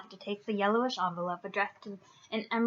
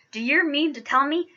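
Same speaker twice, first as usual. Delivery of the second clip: loud, raised, angry challenge; mild growl of outrage; growled anger; 5 semitones higher, 6 dB louder than usual. A narrator's reading. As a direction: confront them with indignant force. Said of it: "he growled at the Dursley".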